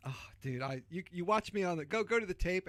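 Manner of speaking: normal talking voice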